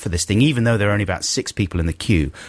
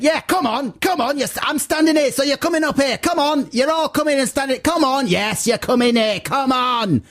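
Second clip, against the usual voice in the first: high, insistent mock matriarch; bully them into the queue; hammed-up high bossy woman insisting; 13 semitones higher than usual. Matriarch insisting they jump queue.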